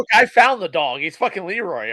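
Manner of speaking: mock announcement. Co-host teasing friend about the found dog, mock proclaiming.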